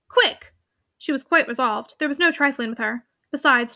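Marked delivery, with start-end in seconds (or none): 0.1-0.5 high, sharp order; sharp command. Acted. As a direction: snap the order out. Ordering him out.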